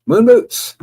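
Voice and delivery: loud, high-pitched shout of greeting; excited call-out. Real, not acted. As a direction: hail a new arrival at full voice. Calls out newly arrived viewer's name.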